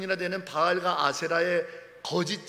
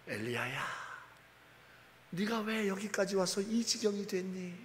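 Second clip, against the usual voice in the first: still small voice; 8 dB quieter than usual. Acted. Preacher voicing God calling Elijah in a soft small voice. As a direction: hush it like a soft call. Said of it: "세미한 음성으로".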